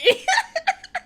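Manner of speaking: high burst of laughter. Real, not acted. Host cracking up at Giselle's joke, high laugh.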